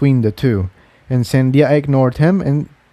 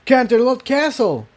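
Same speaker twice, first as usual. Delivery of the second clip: much higher sudden exclamation; startled; 12 semitones higher than usual. Acted. Jigsaw reading the old script over the door, startled. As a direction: blurt it as the realization hits. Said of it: "he said with a start".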